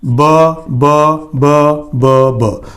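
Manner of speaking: five separate "buh" sounds, evenly counted out; teacher tapping out the repeated "b" sound one by one; isolated b sound. Shown as a demonstration. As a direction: sound out each "b" as "buh", with short pauses. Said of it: "Five times b sound".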